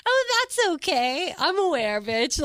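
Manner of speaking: high, sarcastic mock-sweet retort; a pretend clapback, high and catty; high catty imagined reply; snarky comeback.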